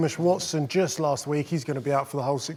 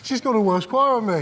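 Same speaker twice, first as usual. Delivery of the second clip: laughing; 6 semitones higher, 5 dB louder than usual. Real, not acted.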